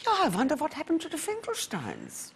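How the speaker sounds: put-on a father's faint German accent, wondering aloud. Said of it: "he spoke like this in South Africa".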